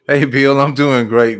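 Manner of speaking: loud, pumped-up hello; excited greeting. Real, not acted. Greeting a livestream viewer, a loud excited hello. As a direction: greet him with a burst of energy.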